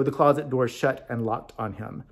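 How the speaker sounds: ordinary delivery, nothing marked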